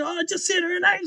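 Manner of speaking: high-pitched mock yell of innocence; protesting yell. Brother answering the nurse's accusation, protesting yell. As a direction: protest it with the voice right up. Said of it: "So I start yelling".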